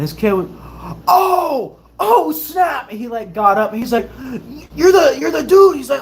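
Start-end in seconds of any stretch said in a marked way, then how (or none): 1.1-2.9 high excited outburst of recognition; imitated starstruck amazement. Kid recognizing him, acted out. 4.7-5.8 high, loud, stumbling excitement; imitated starstruck fan. Excited kid recognizes the YouTuber.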